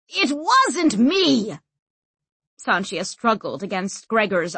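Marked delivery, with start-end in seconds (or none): 0.1-1.6 high, drawn-out shouted denial; shouting. A narrator's reading. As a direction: shout it slowly in protest. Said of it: "and shouted".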